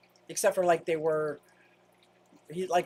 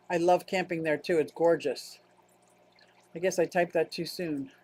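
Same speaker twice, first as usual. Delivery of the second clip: ordinary talk, nothing marked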